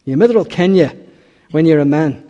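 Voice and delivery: loud Scottish mother's reassurance; Scottish accent. Acted. Mother promises she will know her son, Scottish accent. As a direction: put on a Scottish accent and speak up. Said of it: "in the Scottish accent".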